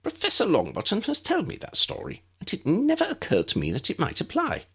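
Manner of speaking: high, bright little wizard voice, kept up; a small, eager professor speaking; tiny professor voice. A narrator's reading. Flitwick resolves to help.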